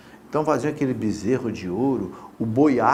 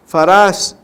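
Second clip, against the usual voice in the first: loud reading; 4 semitones higher, 12 dB louder than usual. Shown as a demonstration. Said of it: "eu vou ler pra você".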